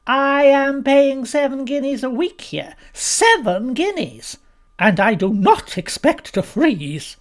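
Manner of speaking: high, loud, slow, emphatic guest; outraged Mrs Boyle. A narrator's reading. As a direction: hammer out the complaint word by word.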